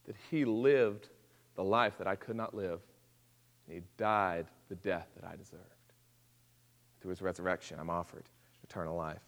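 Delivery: tender quiet confession of faith; hushed. Real, not acted. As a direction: drop to an intimate near whisper. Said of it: "hushed personal confession".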